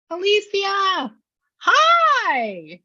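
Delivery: high, drawn-out happy call of hello; excited greeting. Real, not acted. Medium hails client joining the call, excited greeting. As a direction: greet them at a delighted high pitch, stretching it out.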